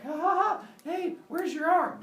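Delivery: high, loud mock laughter; gleeful taunt. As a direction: tease your brother high and loud. Brothers taunt each other in play.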